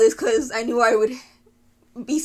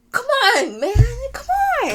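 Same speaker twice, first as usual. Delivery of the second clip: very high, fast wail; half-laughing frustrated begging; exasperated plea; 11 semitones higher than usual. Real, not acted. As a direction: whine it high at a hopeless character. Pleading with a character, exasperated.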